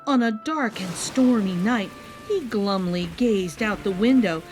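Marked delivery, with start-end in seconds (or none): none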